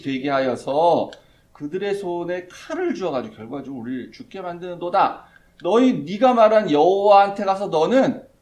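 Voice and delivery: loud furious reproach of the people; 모세와 아론에게 화를 퍼붓는 큰 목소리; pouring out anger. Retold or imitated. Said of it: "화를 퍼붓죠". (백성이 모세에게 화를 퍼붓듯 크게)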